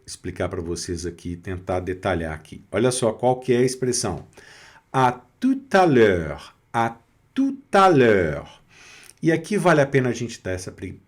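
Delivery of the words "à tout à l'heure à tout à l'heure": slow, stretched "À tout à l'heure"; French demonstration. Shown as a demonstration. Teacher presents a new expression, "À tout à l'heure" slowly enunciated. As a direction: draw out "À tout à l'heure" syllable by syllable. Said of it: "a pronúncia".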